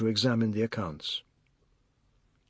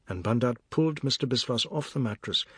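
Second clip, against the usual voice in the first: usual speaking voice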